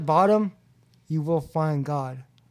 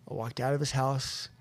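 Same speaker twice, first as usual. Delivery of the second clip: normal talking voice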